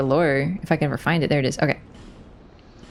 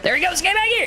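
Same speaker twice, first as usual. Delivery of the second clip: calling out; 10 semitones higher, faster than usual. Real, not acted. Chasing fleeing enemy in game.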